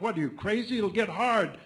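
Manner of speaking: loud, high, incredulous shout; hollering back. Retold or imitated. Baker answering a mad order, hollering back. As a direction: yell back as if he's nuts. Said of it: "And the baker hollers back".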